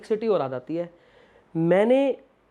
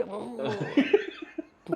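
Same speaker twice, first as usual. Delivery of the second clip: long drawn-out impressed hmm through laughter; laughing mock hmm; 5 dB quieter, much slower than usual. Mimicking a writers-room listener's slow approving hmm. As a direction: stretch the hmm like a listener won over. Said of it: "सामने वाला लगता है कि".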